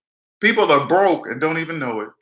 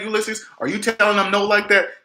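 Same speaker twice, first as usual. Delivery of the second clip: ordinary talk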